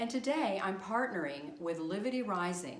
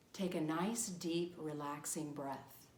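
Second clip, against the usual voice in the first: guided meditation. Acted. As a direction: guide them into relaxation, phrase by unhurried phrase. Leads guided visualization over drumming.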